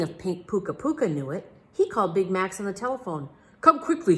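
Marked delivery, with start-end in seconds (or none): 3.6-4.2 urgent, loud and higher king's cry; cries out. A narrator's reading. King phoning the detective for help, urgent cry. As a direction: call out to the detective in alarm. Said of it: "he cried".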